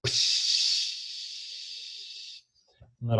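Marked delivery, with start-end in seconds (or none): 0.1-0.8 unvoiced psh of air escaping a valve; soft imitated air release; air hiss. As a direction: let the air out like a tire valve. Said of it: "just went".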